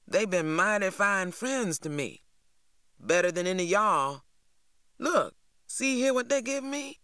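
a hint of a bragging teen, rising at the end; T J boasting. A narrator's reading. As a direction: show off the new friends, pleased with himself. TJ boasting about new friends.

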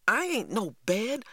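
high-pitched startled protest; indignant exclamation. A narrator's reading. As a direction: exclaim it as someone grabs you. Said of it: "I exclaimed as his hands fell on me".

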